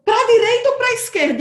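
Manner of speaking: high, loud anxious asking; urgent question. Retold or imitated. Driver asking friend at the fork, anxious and loud. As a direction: demand an answer fast, pitch up.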